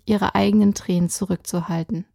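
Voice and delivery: ordinary delivery, nothing marked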